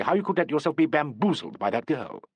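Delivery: plain reading voice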